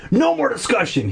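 stern. Acted. Dad ending the argument with his son, stern. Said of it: "he said sternly".

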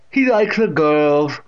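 slow, loud leering statement; Catherine. A narrator's reading. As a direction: leer at him as the deaf great-aunt. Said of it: "leering at him".

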